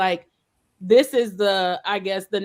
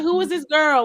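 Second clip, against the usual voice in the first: high, skeptical mock question; suspicious classmates; 8 semitones higher, 4 dB louder than usual. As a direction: imitate the wary kids sizing up the new girl. Classmates eyeing the new girl.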